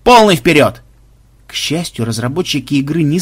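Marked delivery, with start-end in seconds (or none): 0.0-0.8 loud, higher captain's command; barked order. A narrator's reading. Captain sending the pirate ship forward, loud barked order. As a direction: bark it out forcefully to all hands. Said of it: "рявкнул Банзай".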